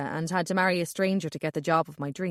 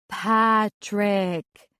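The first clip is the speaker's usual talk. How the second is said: put-on Patrick said in an overdone flat American accent, each letter stressed; obnoxious American accent. Calvin correcting narrator, mock American accent. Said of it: "he repeats in an obnoxious American accent".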